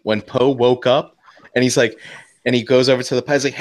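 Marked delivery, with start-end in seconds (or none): none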